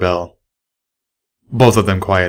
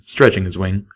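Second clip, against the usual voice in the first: usual speaking voice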